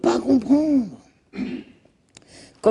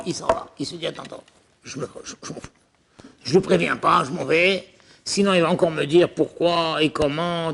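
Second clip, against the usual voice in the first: ordinary delivery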